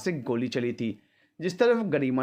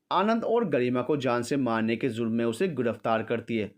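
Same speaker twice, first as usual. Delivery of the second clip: usual speaking voice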